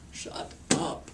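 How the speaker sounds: voiceless, drawn-out disbelief; stunned retold disbelief. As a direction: breathe it out as if floored. Acting out her stunned reaction.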